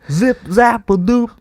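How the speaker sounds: very high scatting Cosby voice; bouncy Cosby nonsense words; Bill Cosby impression. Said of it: "my Bill Cosby impression".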